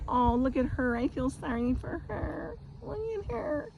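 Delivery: mock-pitying younger women. Younger women pitying an older woman, high mock-pity voice. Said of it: "younger women, looking at me, going".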